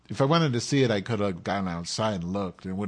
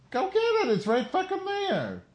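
exasperated pet coaxing. Real, not acted. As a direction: urge the dog to fetch, annoyed. Urging the pet again.

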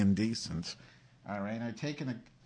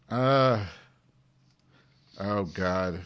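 usual speaking voice

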